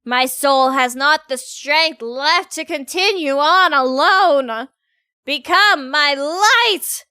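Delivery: loud, slow, theatrical incantation; grandiose Angel character. Acted. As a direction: declaim like a doom prophet, drawing out the last words. Voices Angel's grandiose apocalyptic speech.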